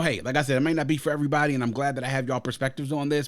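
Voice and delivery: ordinary talk, nothing marked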